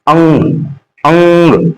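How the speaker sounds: अंग with a ringing nasal ng; nasal first. Shown as a demonstration. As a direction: let अंग sound in the nose first. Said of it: "अनुस्वार का उच्चारण सबसे पहले नाक से".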